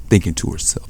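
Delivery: ordinary delivery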